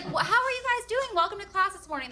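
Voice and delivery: high, bright kindergarten greeting; cheery teacher. Retold or imitated. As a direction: sing it out to five-year-olds.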